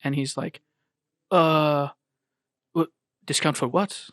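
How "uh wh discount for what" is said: imitating a Korean shopkeeper, rising, puzzled; mock Korean accent on a baffled question. Retelling a sitcom shopkeeper, imitated Korean accent. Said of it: "he's just an old Korean guy".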